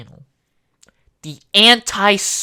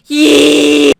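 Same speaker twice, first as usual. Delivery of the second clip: higher loud yee; squeal; 9 semitones higher, 10 dB louder than usual. Acted. Goofing, loud high yelp.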